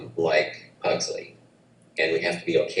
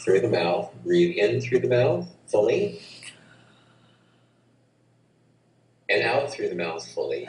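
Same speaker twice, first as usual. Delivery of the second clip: guided breath cues; 3 semitones higher than usual. Shown as a demonstration. Breathwork teacher leading the host through the breath, calm guiding voice. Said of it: "I'll talk us through".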